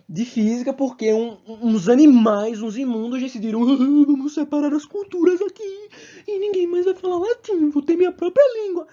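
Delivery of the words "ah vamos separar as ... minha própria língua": high, silly put-on voice; mocking imitation. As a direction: mimic the splitters as high-pitched fools. Mocks those who split cultures.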